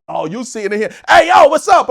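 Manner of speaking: loud, high yelled greeting. Retold or imitated. [name] calling out to the comic, loud yelled greeting. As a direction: call out hey yo across the room. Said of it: "[name] comes walking up to say".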